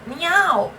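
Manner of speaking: high, loud imitated meow; a mock cat scream. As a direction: yowl it like a cat calling. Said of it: "I can scream".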